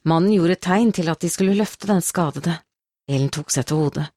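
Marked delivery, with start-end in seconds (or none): none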